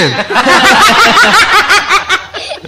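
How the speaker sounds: very high laughter; real laugh at the punchline; high peal of laughter. Real, not acted. Laughing at own joke.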